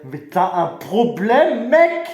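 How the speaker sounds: slow, loud, rising confrontation; angry challenge. Acted. Candidate squaring up to his rival, rising angry challenge. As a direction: stretch it out, climbing to a high loud finish.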